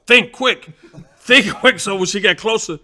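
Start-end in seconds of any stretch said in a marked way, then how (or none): 0.1-1.8 urgent to himself. Retold or imitated. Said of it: "I said".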